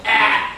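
loud, high mock "Ah!" to open the mouth; a hammed-up exam-room "Ah!"; mock doctor's ah. Doctor makes kid say ah.

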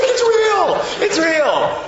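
very high, loud mock shouting; hammed-up frantic yell; frantic insistence. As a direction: shriek it, desperate to be believed. Brother insists, acted.

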